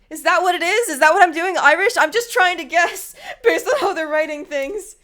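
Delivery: laughing disbelief. Real, not acted. Player realizing she voiced Gina Irish, loud and laughing. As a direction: crack up at her own accent. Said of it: "realizing her own accent choice".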